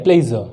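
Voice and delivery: प्लेजर as "ple-zuh", falling to finish; z sound and schwa ending in प्लेजर; correct pronunciation. Shown as a demonstration. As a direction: conclude on प्लेजर with z and silent r. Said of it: "तो ये है".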